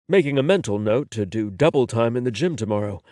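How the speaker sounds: ordinary delivery, nothing marked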